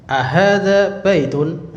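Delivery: "ahadza baitun" said slowly, rising at the end; question intonation. Shown as a demonstration. Teacher shows the intonation of asking if it's a house, questioning tune. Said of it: "intonasinya gitu".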